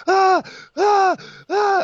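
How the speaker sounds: high, loud excited yelps; wordless yelling. Retold or imitated. Cohost acting out his own yelling in the background of the broadcast, high loud yelps. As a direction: yelp over and over like a knockout just landed. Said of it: "I'm just yelling".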